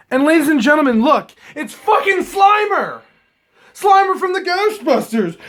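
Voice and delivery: high, loud, excited shouting; thrilled shout. Real, not acted. Showing viewers the Slimer card, real excited high shout. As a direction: yell it like a fan who just found his favourite card.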